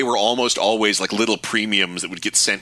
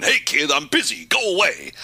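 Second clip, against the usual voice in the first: higher-pitched put-on voice, mock panting; Al Lowe, gasping; 7 semitones higher than usual. Quoting a designer's game voice sample, gasping put-on voice. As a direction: imitate a breathless brush-off in a high voice. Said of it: "it's just him gasping and panting and going".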